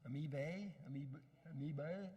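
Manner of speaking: Amoebae tried out as a question, ending in "bay"; unsure pronunciation. Real, not acted. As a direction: stumble over "amoebae", testing the plural with a questioning rise. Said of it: "not sure how you pronounce it".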